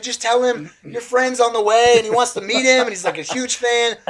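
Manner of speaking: high-pitched, urgent retold plea; urgent pleading. Teen begging a friend over the phone to stall his idol, urgent.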